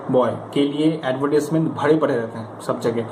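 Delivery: ordinary talk, nothing marked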